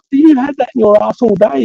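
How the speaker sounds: high, shocked disbelief. Retold or imitated. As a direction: gasp it out in horror. Narrator's own shocked quote.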